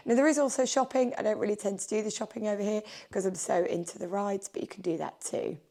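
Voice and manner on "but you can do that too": ordinary talk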